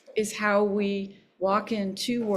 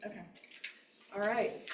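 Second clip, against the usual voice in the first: normal talking voice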